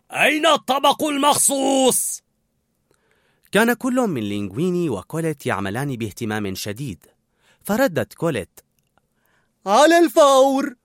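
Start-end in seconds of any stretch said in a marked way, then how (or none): 0.1-2.2 high, shouted question; loud demand. A narrator's reading. Sous-chef to two cooks, loud demand. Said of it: "سألهما هورست بصوت عال". 9.7-10.8 slow, high, loud acknowledgement; shouted reply. A narrator's reading. Cook answering the sous-chef's order, shouted reply.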